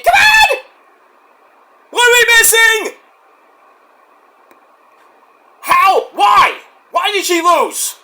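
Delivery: loud, high shouted disbelief; outraged rant. Real, not acted. Fan shouts at the bookers in outrage over the loss. Ranting at the booking decision.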